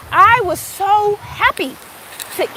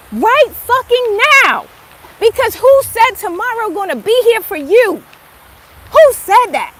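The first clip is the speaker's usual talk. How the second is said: preaching at full force; 5 semitones higher, 5 dB louder than usual. Acted. Urging listeners not to wait for tomorrow, loud preaching. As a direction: preach it hard at them.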